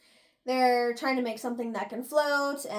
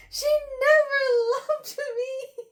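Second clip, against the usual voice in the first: high, slow, louder sobbing; a high, slow sobbing wail; 7 dB louder, slower than usual. Retold or imitated. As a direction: wail it slowly through tears. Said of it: "really going at it".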